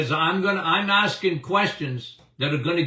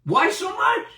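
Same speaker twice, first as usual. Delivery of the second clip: rhetorical outcry; 13 semitones higher than usual. Real, not acted.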